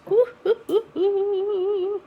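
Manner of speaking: high, loud excited hooting; excited whoops. Real, not acted. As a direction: whoop with delight at the foam. Foam bubbling up, cheering.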